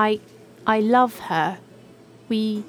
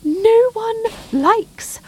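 excited outburst; 8 semitones higher, 5 dB louder than usual. Acted. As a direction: burst out with giddy release. Golfer bursts out in relief.

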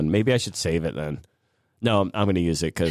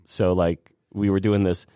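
usual speaking voice

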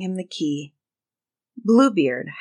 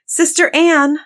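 high, raised call to a sister; 10 semitones higher, 6 dB louder than usual. A narrator's reading. Doomed wife summoning her sister, calling out. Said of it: "she called out to her sister".

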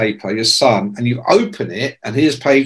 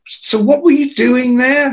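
retold amazed question; 10 semitones higher, 3 dB louder than usual. Amazed questioning of his friend.

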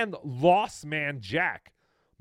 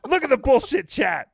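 higher, louder mock rant; mock streamer yell, higher and louder; imitating the streamer; 9 semitones higher, 10 dB louder than usual. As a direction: parrot his outburst, hammed up. Echoing the streamer's outburst.

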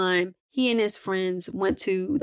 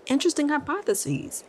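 thoughtful librarian; 8 semitones higher than usual. A narrator's reading. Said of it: "Missus Simon said".

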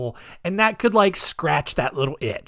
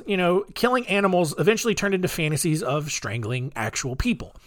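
ordinary talk

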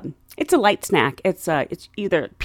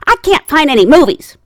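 higher, louder mimicked grumble; complaining old lady; 6 semitones higher, 6 dB louder than usual. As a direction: complain like a helpless elderly passenger.

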